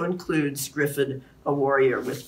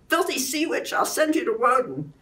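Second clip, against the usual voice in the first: much higher, wild threatening snarl; frenzied warrior; 9 semitones higher than usual. A narrator's reading. Griffith mistaking Lancelot for an enemy, wild high threat. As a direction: threaten her like a madman.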